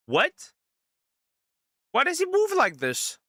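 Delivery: ordinary talk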